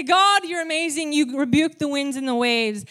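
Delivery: loud, high declaration of praise to God; a confident prayer, said loud and high; bold praise. Shown as a demonstration. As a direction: proclaim it with full confidence. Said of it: "So we can say".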